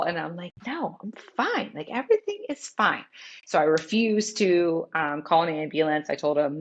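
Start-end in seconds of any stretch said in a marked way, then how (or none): 0.7-3.0 retold insistent denial. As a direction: act out brushing off alarm, pitch lifted. Insisting to husband she is fine.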